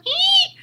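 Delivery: tiny shrill eek; high squeal. Real, not acted.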